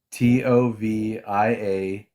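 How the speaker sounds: slow letter-by-letter spelling; spelled out. Shown as a demonstration. Co-host spelling a rabbi's name for a caller. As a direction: spell each letter slowly and clearly. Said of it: "spells the name out".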